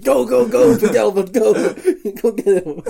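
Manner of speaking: high, loud cheering on; excited urging shout. Retold or imitated. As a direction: yell him into the charge. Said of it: "I imagine him, like".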